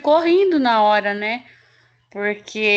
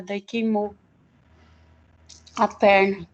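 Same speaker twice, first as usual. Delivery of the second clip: normal talking voice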